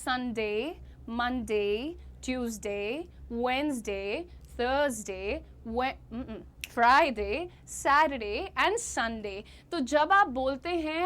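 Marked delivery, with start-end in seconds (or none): 0.1-9.4 each day said slowly and clearly. Shown as a demonstration. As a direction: slowly spell out the weekdays. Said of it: "clear pronunciation कीजिए". (हर दिन का नाम धीरे और साफ़)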